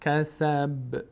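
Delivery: کسب slow with the final ب bouncing; qalqala on ب. Shown as a demonstration. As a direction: let the ب of کسب vibrate.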